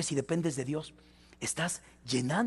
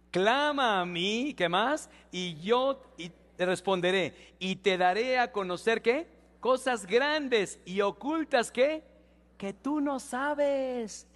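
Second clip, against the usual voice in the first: high, slow, stretched-out proclamation; preaching verse recitation; 6 semitones higher, 3 dB louder, slower than usual. Real, not acted. Pastor leads the church through a memorized Jeremías verse, slow high proclamation. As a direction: call out the verse and prompt the crowd to finish.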